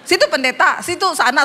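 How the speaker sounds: mildly huffy pastor talking back; mock annoyed. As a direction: play the irked pastor. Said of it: "Saya jengkel".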